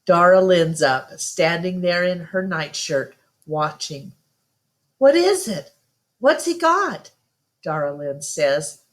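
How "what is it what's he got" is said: put-on high, insistent child's voice; a little girl tugging at her mother, pushing for an answer; pestering little sister. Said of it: "pestering Ma's arm".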